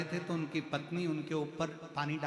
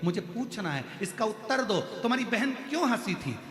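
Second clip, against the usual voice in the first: insistent demand; 6 semitones higher than usual. Retold or imitated. King demands answer from sister-in-law.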